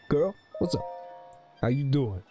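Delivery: ordinary talk, nothing marked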